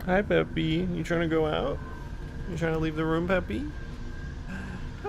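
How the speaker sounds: high, sing-song pet voice. Real, not acted. Streamer coos at his cat Peppy, high baby talk.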